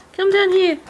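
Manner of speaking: high, sing-song coaxing to a bird; pet talk voice. Real, not acted. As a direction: coax a shy budgie out. Calling bird down.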